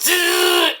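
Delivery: imitated taser zap; taser buzz. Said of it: "Mick Foley went".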